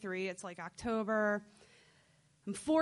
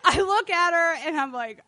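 laughing; 7 semitones higher, 11 dB louder than usual. Real, not acted. Narrating while laughing.

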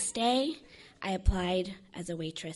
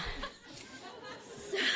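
airy little laugh; breathy giggle; 11 semitones higher, 5 dB quieter than usual. Real, not acted. Laughing at her own story, airy giggle.

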